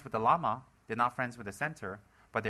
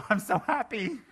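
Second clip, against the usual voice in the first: much higher, squeaky mock delight; mock happy squeal; 12 semitones higher, 5 dB louder, slower than usual. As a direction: squeal it like a giddy cartoon. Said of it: "And she'll be like".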